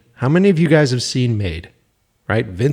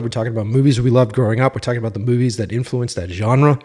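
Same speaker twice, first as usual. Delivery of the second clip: ordinary talk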